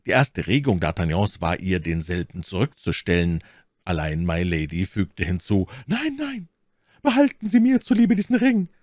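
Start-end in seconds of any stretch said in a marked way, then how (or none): 5.9-8.7 very high, insistent lady's voice; voice full of emotion. A narrator's reading. Milady pressing her ring on the count, deeply moved. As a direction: plead with feeling, high-pitched. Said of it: "fügte sie mit bewegter Stimme bei".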